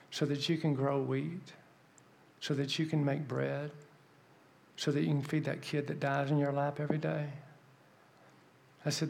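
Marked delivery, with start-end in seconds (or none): 0.1-7.3 soft, measured, building phrase by phrase; earnest plea. Retold or imitated. Asking the lady about praying for rain, an earnest quiet plea. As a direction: press the question gently and quietly.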